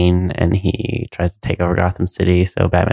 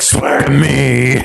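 drawn-out vigilante roar; hammed-up slow interrogation voice; Batman; 4 semitones higher, much slower than usual. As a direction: stretch it out as a menacing superhero. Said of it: "And then Batman's like".

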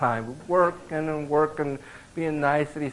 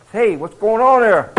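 hearty phone greeting; 7 semitones higher, 10 dB louder than usual. Retold or imitated. Client calling the sales desk.

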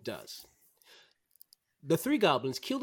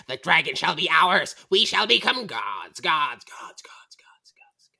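loud goblin boast fading into whispers; gloating creature voice with dying echo; scheming goblins; 7 dB louder, slower than usual. Acted. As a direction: proclaim it grandly, then echo away to a whisper. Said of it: "you hear the giggles of the goblin".

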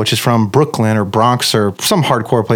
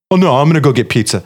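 mocking Neil; faster than usual. Neil mocked defiant, fast.

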